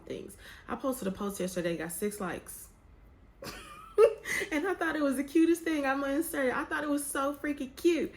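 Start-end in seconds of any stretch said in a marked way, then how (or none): 3.4-4.5 a sudden, very high laugh. Real, not acted. Laughing at her post getting only six likes, high burst of laughter. 4.5-8.1 high, fast and louder gushing. Real, not acted. Gushing about her own post that got six likes, high and quick.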